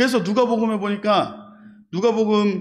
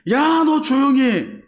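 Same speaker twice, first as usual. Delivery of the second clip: higher, louder drawn-out telling-off; sharp rebuke; 5 semitones higher, 3 dB louder, slower than usual. Acted. Other robber silencing his fellow, rebuking. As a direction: tell him off, slowly and firmly.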